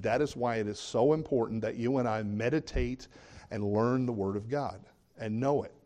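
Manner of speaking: ordinary talk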